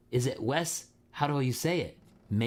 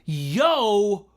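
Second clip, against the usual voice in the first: high, loud, drawn-out hype call; big loud radio-host greeting; excited shout-out; 10 semitones higher, 8 dB louder, much slower than usual. Real, not acted. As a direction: hype up a viewer joining the stream. Greeting a viewer by name.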